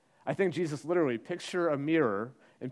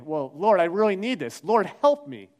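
loud, higher, direct plea; assertive plea; 4 semitones higher, 8 dB louder than usual. Retold or imitated. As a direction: demand help, pressing and urgent. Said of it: "she gets a little more direct, a little more assertive".

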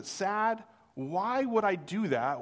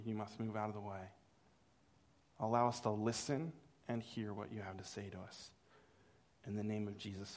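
low, soft praying voice; prayer; 6 semitones lower, 9 dB quieter than usual. Real, not acted. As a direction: pray quietly, pitched well down.

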